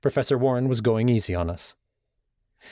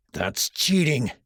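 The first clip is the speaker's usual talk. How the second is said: growled accusation; 4 semitones higher, slower than usual. Acted. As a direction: spit it out slowly like a sore loser. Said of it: "Ryan growled".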